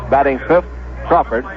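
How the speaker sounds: ordinary talk, nothing marked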